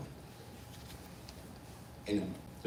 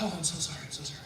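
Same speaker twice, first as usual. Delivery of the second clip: soft, meek, fading away; sheepish apology; 9 dB quieter, much faster than usual. Retold or imitated. Scolded actor to stage manager, sheepish. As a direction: shrink back as you apologize.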